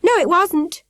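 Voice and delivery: high, loud protest; boy insists he saw the ghost too; protesting cry. A narrator's reading. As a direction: protest urgently. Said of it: "Peter cried".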